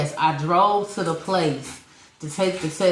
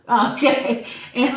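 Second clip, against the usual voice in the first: laughing; 5 semitones higher, 4 dB louder than usual. Real, not acted. Laughs at being corrected.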